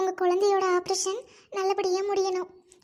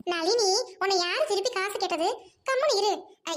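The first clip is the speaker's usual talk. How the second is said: normal talking voice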